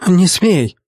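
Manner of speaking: urgent cry to stop him; shout. A narrator's reading. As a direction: call out in alarm. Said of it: "крикнула девушка".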